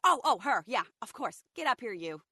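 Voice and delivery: loud, eager call-up; excited. Retold or imitated. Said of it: "Jess sees me and is like".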